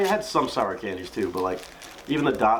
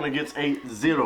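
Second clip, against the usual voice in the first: ordinary talk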